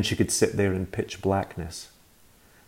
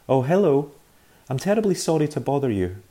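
higher, prim telephone politeness; overly polite boy; 7 semitones higher, 4 dB louder than usual. Acted. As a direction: put on a polite little voice, a touch higher. Boy speaking politely on the phone.

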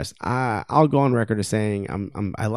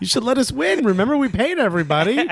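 higher, squeaky mock whine of a pampered team; mock entitled players; 11 semitones higher than usual. Host mocks PSG players begging opponents, high mock whine. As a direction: whine like spoiled rich players expecting a win.

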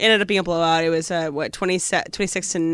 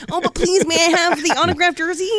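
high pleading voice, pitched way up; hammed-up begging; pleading mock fan; 10 semitones higher than usual. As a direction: beg like a starstruck fan. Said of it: "you're like".

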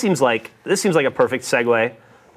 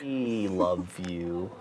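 soft and slow, trailing unsure; flat unsure we love you; 7 semitones lower, 13 dB quieter, much slower than usual. Retold or imitated. Imitating husband's flat we love you, soft and slow. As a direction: say it quiet and slow, not quite sincere. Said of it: "the way he says that".

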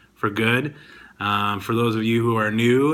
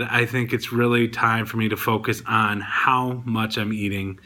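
usual speaking voice

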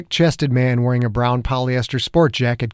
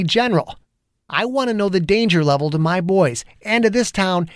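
building heated cop rant, pitched up; angry rant; 7 semitones higher than usual. A narrator's reading. Angry detective venting at the federal agent. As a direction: vent the whole bad day at him. Zimmer's defiance.

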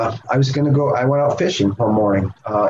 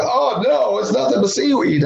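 dismay; 11 semitones higher than usual. Retold or imitated. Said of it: "and I said".

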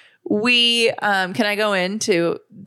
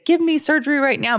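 demanding; 8 semitones higher than usual. Retold or imitated. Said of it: "march in there and say".

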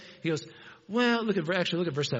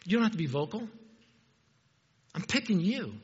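tender God voice; 5 dB quieter, faster than usual. Retold or imitated. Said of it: "God looks out at us and he goes".

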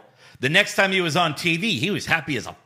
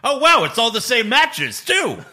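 higher and louder, mock amazed; mock surprise; 8 semitones higher, 6 dB louder than usual. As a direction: act stunned by rematches. Joining the confused fan bit.

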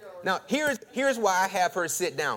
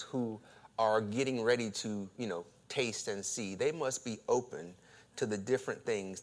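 softer, measured pulpit voice; sermon intro; 7 semitones lower, 9 dB quieter than usual. Acted. Pastor introducing a new sermon series, soft and measured. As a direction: open the sermon gently.

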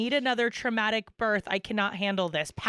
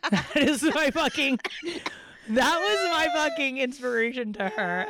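talking through real laughter; laughing; 3 semitones higher than usual. Real, not acted. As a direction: crack up while getting the words out. Cracking up after reading own texts.

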